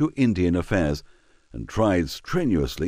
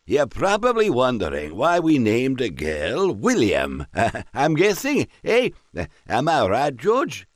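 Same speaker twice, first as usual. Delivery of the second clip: put-on higher, mumbling, laughing drunkenly; a merry drunk mumbling his joke; drunk squire; 6 semitones higher, 3 dB louder than usual. A narrator's reading. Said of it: "mumbled almost incoherently".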